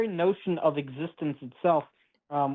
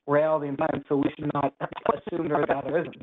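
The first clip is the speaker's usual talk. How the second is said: ordinary talk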